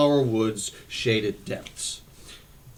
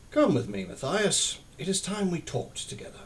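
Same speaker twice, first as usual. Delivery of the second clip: put-on gentle, softening old abbot; kindly; 5 semitones higher, 6 dB quieter than usual. A narrator's reading. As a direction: speak softly to a young novice. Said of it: "spoke kindly to him".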